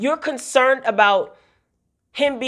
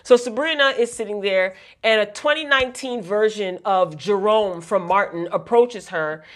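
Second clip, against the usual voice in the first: ordinary delivery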